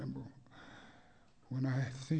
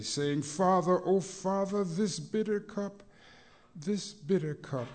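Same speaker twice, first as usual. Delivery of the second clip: chanting sermon with swelling peaks; preached poem; 11 dB louder than usual. Acted. Rhythmic preached poem throughout.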